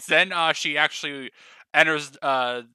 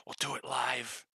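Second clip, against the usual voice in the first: whispered resolve; 4 semitones lower, 10 dB quieter than usual. Real, not acted. GM whispering resolve to keep the stream going. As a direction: murmur it, determined. Pushing on despite problems.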